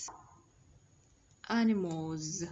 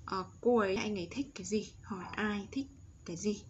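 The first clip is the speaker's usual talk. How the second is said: ordinary delivery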